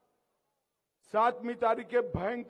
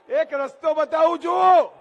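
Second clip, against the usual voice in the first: loud, high shout to a crowd; raised rally-stage call; calling for attention; 5 semitones higher, 12 dB louder than usual. Real, not acted. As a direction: holler to make the whole crowd listen.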